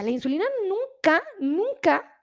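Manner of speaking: "nunca" said twice, each swung up high; emphatic stress on nunca. Acted. Doctor correcting uncle Juan about insulin, emphatic "nunca". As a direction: hammer each "nunca" with a high pitch peak.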